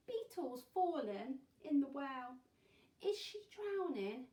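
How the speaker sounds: high, loud, worried question; storybook alarm. A narrator's reading. Reading the beetle's fall to children, alarmed storytelling. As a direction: raise the alarm dramatically.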